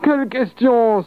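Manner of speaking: very high, mischievous cry; mischievous exclamation. A narrator's reading. Peasant answering the devil's question, mischievously exclaiming. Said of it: "s'exclama malicieusement le coloquant".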